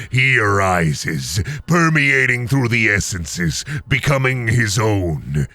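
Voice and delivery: low, slow and ominous, like a menacing beast; monster cow voice. Pretend. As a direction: speak as a dark monster cow, dragging the words out. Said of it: "Cow goes".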